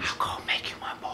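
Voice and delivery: fast creepy whisper. Acted. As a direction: hiss it quickly under breath. Mock whisper echo.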